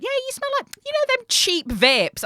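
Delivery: high, slowed-down teasing mimic of her friend; mocking imitation. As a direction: parody her friend's clumsy compliment. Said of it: "You doubled down and went".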